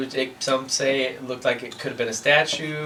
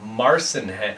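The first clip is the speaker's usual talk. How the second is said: ordinary talk, nothing marked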